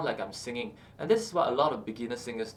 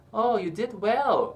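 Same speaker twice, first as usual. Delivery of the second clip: higher, slow and encouraging; 7 semitones higher, 5 dB louder, slower than usual. Shown as a demonstration. Coach demos praising someone, slow encouraging voice. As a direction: praise them warmly and slowly. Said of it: "encouraging someone".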